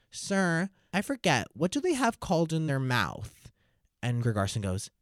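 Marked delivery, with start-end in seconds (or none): 0.1-3.5 high, slow questioning pupil; schoolkid. Retold or imitated. Pupil with hand up asking teacher, imitated schoolkid. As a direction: imitate a kid putting on innocence for the teacher. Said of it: "And the kid said".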